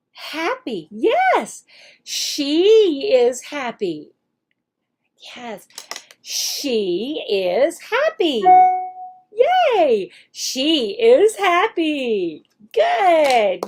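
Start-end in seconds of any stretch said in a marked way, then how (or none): 2.0-8.4 slow, drawn-out answer; slow modeled answer. Shown as a demonstration. Teacher models the child's answer, slow. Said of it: "teacher acts out the lesson". 9.4-10.1 cheer. Shown as a demonstration. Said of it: "teacher praising pretend student". 10.3-12.4 slow, drawn-out answer; slow modeled answer. Shown as a demonstration. Teacher models the child's answer, slow. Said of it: "teacher acts out the lesson".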